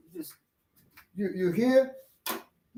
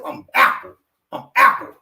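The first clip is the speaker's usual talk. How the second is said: loud, forceful imitation, pitched up; Apple; 7 semitones higher, 8 dB louder than usual. Beggar in a wheelchair telling the driver his name, loud and forceful. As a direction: announce the name hard, twice. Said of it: "what's your name".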